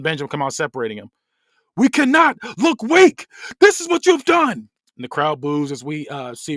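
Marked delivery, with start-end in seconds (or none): 1.8-4.7 high, very loud accusing shout; slow, punched-out angry roar; furious yelling. Acted. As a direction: scream it out, hitting every word. Wrestler screaming at his beaten son.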